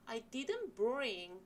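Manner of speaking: "didn't bring" pressed hard and loud; stress on didn't bring. Shown as a demonstration. Teacher showing which words to stress in the apology. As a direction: hit "didn't bring" with force. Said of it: "세게 읽으시고요".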